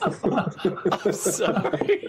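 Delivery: high, drawn-out words through real laughter; helpless laughter. Real, not acted. Player losing it over the dry pulling joke, laughing helplessly. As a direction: try to apologize while cracking up.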